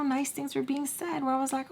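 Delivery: ordinary delivery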